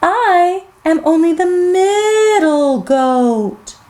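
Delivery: slow, drawn-out goat voice; middle goat pleading. Acted. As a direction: bargain as a worried goat, stretching key words.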